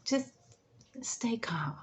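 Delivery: whispered advice. Shown as a demonstration. Advice to caregiver.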